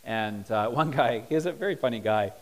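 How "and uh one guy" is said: ordinary talk, nothing marked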